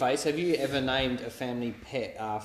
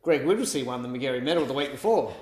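ordinary talk, nothing marked

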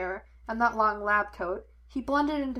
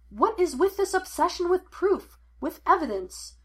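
high, louder exasperated outburst; pitched-up, argumentative demand; 9 semitones higher, 5 dB louder than usual. A narrator's reading. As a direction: challenge him in frustration. Frustrated son challenges father in argument.

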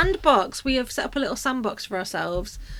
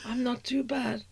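a hint of a suave film star; mock James Mason answering her friend; James Mason impression; 7 dB quieter, slower than usual. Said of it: "one of those impressions that James Mason".

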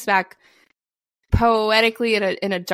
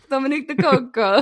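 hammed-up Italian pronunciation, higher; mock Italian; 5 semitones higher than usual. Mocking Landa's over-the-top Italian, voice pitched up. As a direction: roll it out like a showy Italian.

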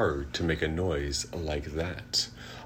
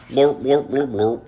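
loud high honking horn; horn blurp; 6 semitones higher, 9 dB louder than usual. A narrator's reading. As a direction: blare like a horn. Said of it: "He can go like a horn".

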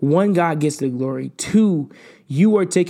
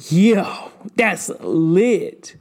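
excited; 5 semitones higher, much slower than usual. Real, not acted. Preacher marveling at what God did, excited outburst. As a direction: exclaim it like you can't believe it. Amazed at God's work.

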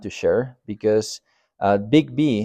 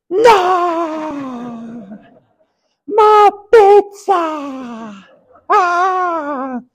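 very high, drawn-out wailing scream; screaming child; 6 dB louder, much slower than usual. Retold or imitated. Pastor mimics Thomas screaming over stolen pizza, very high wailing scream. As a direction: imitate a child shrieking in outrage. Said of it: "not only screaming".

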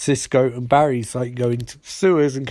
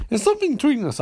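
mock swoon; 11 semitones higher, much faster than usual. Retold romantic line.

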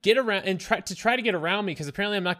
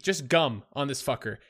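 ordinary talk

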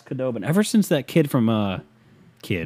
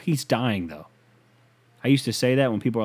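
ordinary talk, nothing marked

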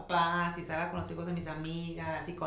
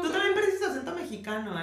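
mock accusing; 10 semitones higher, 4 dB louder than usual. Mock accusation, continuing wail.

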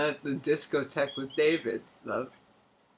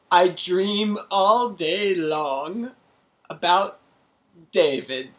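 dreamy and slow; 3 semitones higher, 4 dB louder, slower than usual. Pretend. Dreamy confession of love.

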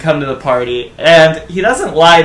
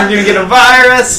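alarmed warning; 7 semitones higher, 4 dB louder, faster than usual. Retold or imitated. Retold warning, fast.

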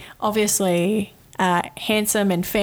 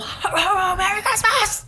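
much higher, cheery festive yell on ho, ho, ho, Merry Christmas; mock child scream; 12 semitones higher than usual. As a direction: belt out ho, ho, ho like a shrieking kid. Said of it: "Like, really, really loud".

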